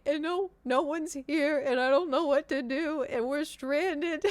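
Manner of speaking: high, wailing retold breakdown; hammed-up tearful plea, dragging out the end; mock crying. Telling the airline agent we're stranded, mock crying. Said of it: "when you're about to cry".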